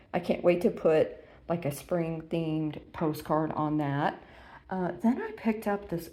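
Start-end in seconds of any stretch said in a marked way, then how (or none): none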